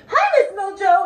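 high, loud hello to a cat; excited pet greeting. Real, not acted. Vlogger spotting her cat, excited greeting.

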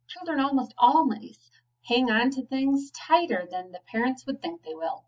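usual speaking voice

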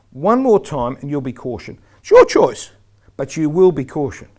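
loud, emphatic ultimatum; firm warning. Shown as a demonstration. Referee giving the slap before the kiss, firm loud warning. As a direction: lay down the consequence forcefully.

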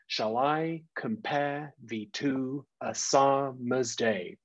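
"Shall I compare thee to a summer's day" slowly, in short-LONG pairs; slow scansion leaning on "I", "-pare", "to", "sum-", "day"; iambic stress. Shown as a demonstration. Teacher demonstrates stressing a sonnet line, exaggerated slow iambic stress on every second syllable. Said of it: "So short, long, short, long".

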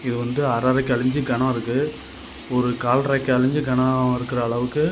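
ordinary delivery

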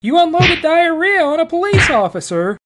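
high, loud, outraged yell; outraged shout. Acted. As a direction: blast the accusation in a high voice. Outraged cellmate scolding.

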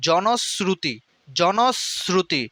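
জনশ্রুতি slowly as "jonos-sruti"; s sound in জনশ্রুতি. Shown as a demonstration. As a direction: hold the s in জনশ্রুতি before "sruti". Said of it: "এভাবে পড়তে হবে". (জনশ্রুতি ধীরে "জনোস্-স্রুতি")

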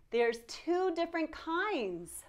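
ordinary delivery

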